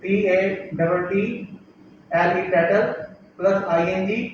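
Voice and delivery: letters spelled out slowly; dictating spelling letter by letter. Shown as a demonstration. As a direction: spell each letter deliberately. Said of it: "जैसे मैंने लिखा है tattle".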